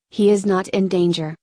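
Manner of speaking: usual speaking voice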